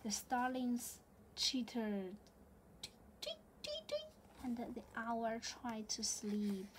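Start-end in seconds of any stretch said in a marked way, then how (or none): 2.8-4.1 bird tweet. Acted. Starling sound in storybook.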